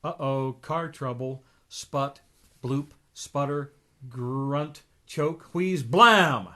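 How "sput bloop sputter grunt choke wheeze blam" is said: sputtering car noises. A narrator's reading. Reader acts out the old car breaking down, ending in a bang. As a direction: sputter and wheeze, then a loud blam.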